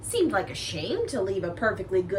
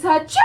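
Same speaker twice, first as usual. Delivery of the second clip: big acted sneeze; loud sneeze; 6 semitones higher, 6 dB louder than usual. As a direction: let out a great sneeze. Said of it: "I sneezed a great sneeze".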